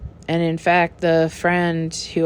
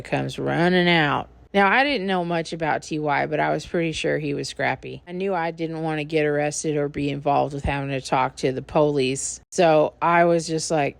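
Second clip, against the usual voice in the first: normal talking voice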